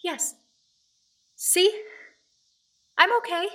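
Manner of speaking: high, falsely brave voice; nervous reassurance. Acted. Visitor reassuring a giant, nervously high. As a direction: pretend you are not scared. Pretending not to be scared.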